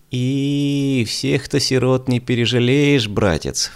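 put-on slow, high sneering old maid; snorting scornfully. A narrator's reading. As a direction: scoff at his kindness. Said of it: "фыркнула свояченица".

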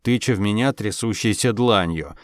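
ordinary delivery, nothing marked

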